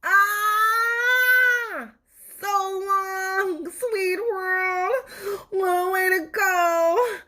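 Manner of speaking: high, crying melodramatic farewell; dying tooth. Acted. Tooth bids the world farewell as it's crunched, high and tearful. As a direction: ham up a last goodbye.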